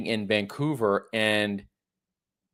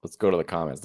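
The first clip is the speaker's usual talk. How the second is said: ordinary talk, nothing marked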